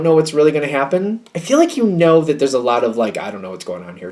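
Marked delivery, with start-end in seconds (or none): 3.2-4.1 much softer, lower, murmured to oneself; hushed unsure thought. Retold or imitated. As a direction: voice the doubt under your breath.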